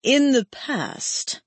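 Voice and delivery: drawn-out, detached and final; cold slow reply. Acted. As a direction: dismiss her with icy calm.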